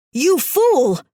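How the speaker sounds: high, loud shrieked insult. Acted. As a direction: shriek at him in contempt. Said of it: "the Lucia in my body shrieked".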